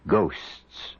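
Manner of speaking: ordinary talk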